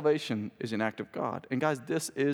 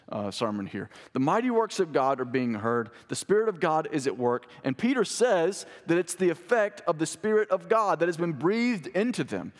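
normal talking voice

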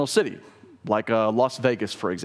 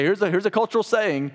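lightly voiced culture, pitched up; a hint of a mock spokesman; 6 semitones higher than usual. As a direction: quote the crowd in a higher voice. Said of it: "the culture would say".

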